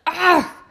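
loud high yelp of pain; a loud, high startled yelp; sharp cry. A narrator's reading. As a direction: cry out suddenly as if hurt. Narrator's sudden pained cry.

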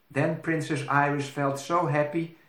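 ordinary delivery

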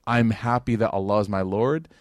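normal talking voice

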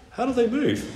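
high eager question; curious boy. Acted. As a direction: ask like a wondering child.

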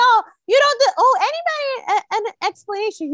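high, insistent interruption; mock shutting down. She keeps shutting him down.

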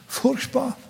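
high, soft pained sigh of a word; furchtbar. Real, not acted. Preacher picturing the scourging, soft pained sigh. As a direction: let the horror escape softly. Preacher sighs over horror.

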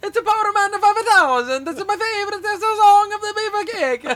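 very high, loud hype voice; hammed-up wrestler voice. Cohost goofing as a wrestler, very high loud mock hype. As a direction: sell it like a cartoon wrestler.

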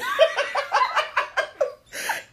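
long high-pitched laughter; high laughing fit. Real, not acted. Laughing hard while retelling the scene to friends. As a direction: lose it laughing.

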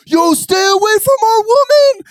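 high, loud shouted threat; angry shout. Retold or imitated. Man yelling at the group to back off, loud shout. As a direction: bellow it at the intruder.

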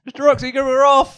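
very high drive-by yell; pretend calling out. Player shouting to the mayor from a passing cart, high mock call. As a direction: holler a hammed-up farewell, pitched right up. Yells to mayor while driving past.

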